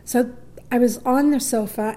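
ordinary talk

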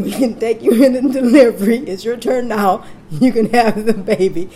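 loud retelling through laughter; laughing doctor quote. Retold or imitated. Doctor finally granting her turn, imitated loudly with laughter. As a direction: laugh while imitating a triumphant announcement.

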